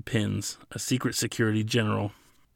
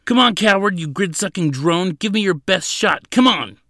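loud, high-pitched goading yell; yelled taunt; 9 semitones higher, 7 dB louder than usual. A narrator's reading. Prisoner pounding the glass taunts the guard, yelling. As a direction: hurl insults at full voice. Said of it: "Ladin yelled".

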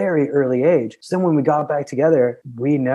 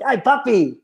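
higher, giggling through a Spanish phrase; laughing mock Spanish; 9 semitones higher than usual. Boy back from school, laughing through playful Spanish. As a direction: tease in Spanish while cracking up.

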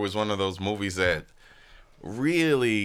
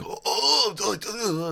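very high, garbled mock moaning; goofy high-pitched babble; Forrest Whitaker's weird voice; 12 semitones higher, much slower than usual. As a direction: imitate a bizarre, unplaceable movie voice. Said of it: "he had that weird sort of".

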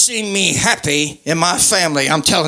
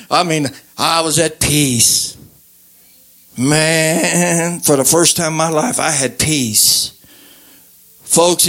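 tender preaching appeal; 4 semitones lower than usual. Real, not acted.

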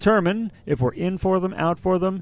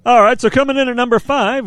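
higher, louder radio countdown host; Casey Kasem; 7 semitones higher, 5 dB louder than usual. Acted. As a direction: announce it like a countdown DJ. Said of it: "we're gonna go Casey Kasem here".

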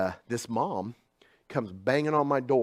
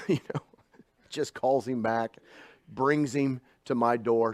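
normal talking voice

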